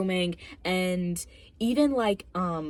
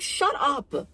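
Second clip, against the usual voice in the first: higher, slow, drawn-out "shut up"; exasperated outburst; 7 semitones higher, 3 dB louder than usual. Real, not acted. Reviewer fed up with pretentious talk, exasperated outburst. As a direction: stretch it out, thoroughly fed up.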